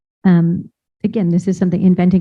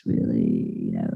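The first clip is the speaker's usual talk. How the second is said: late night DJ voice; 10 dB quieter than usual. Shown as a demonstration. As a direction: drop into a smooth late-night radio murmur. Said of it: "the late night F M D J voice".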